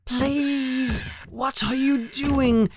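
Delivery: high, urgent plea, held back in volume; restrained yell at someone making noise; mild acted yell. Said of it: "Harry yells at the creature".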